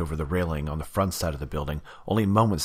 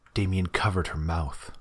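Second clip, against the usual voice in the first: plain reading voice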